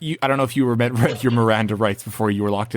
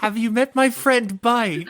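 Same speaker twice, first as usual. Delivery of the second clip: mock introduction; 11 semitones higher than usual. Teasing joke voice.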